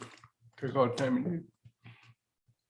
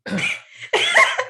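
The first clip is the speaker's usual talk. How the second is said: high laughing cough; 8 dB louder than usual. Real, not acted.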